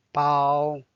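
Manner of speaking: Bao held flat in first tone. Shown as a demonstration. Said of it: "Bao is the first tone".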